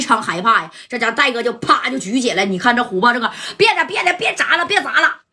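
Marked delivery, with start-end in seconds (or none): 3.6-5.2 high-pitched frantic pleading; a thug suddenly terrified of the rock; panicked begging. Acted. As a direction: beg for your life, voice up high. Hubao begs not to be hit.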